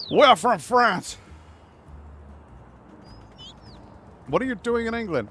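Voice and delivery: put-on high, loud taunting French accent; French Knights. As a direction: shout it like a hammed-up French knight. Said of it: "French Knights and Monty Python".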